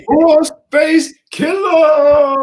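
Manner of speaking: laughing, shrieking the name out high; excited shout. Real, not acted. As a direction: scream it with delight. Hyped shouting the comic name.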